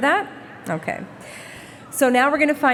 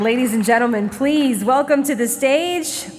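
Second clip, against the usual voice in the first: announcer; 2 semitones higher than usual. Acted. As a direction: boom each name out over the music. Announces finalists to the stage.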